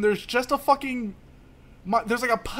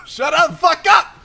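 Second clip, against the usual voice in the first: high, loud yell; shouting; 8 semitones higher, 9 dB louder, slower than usual. Real, not acted. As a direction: shout them down. Yelling at co-hosts.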